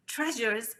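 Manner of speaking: usual speaking voice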